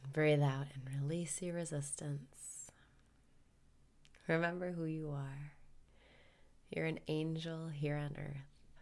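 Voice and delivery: calm guru voice leading the breathing; guided meditation. Acted.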